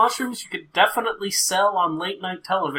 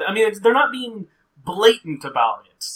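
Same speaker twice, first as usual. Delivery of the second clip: usual speaking voice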